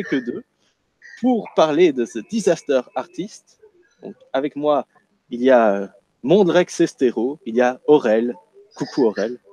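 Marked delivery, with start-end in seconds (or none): none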